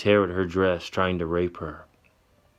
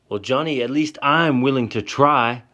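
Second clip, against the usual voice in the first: high, loud pushback, defiant; louder, hurt defiance; screaming back; 5 semitones higher, 5 dB louder than usual. Acted. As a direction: throw it back at him. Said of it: "she screams back".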